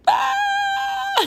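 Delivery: high delighted squeal; fangirl squeal. Real, not acted. Fan squealing when they use first names, high and giddy.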